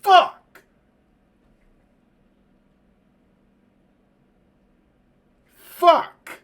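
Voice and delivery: loud, very high single-word yell; shouted curse. Real, not acted. Gamer overwhelmed by such a good game, shouting. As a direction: let each curse burst out. Cursing at the game's twist.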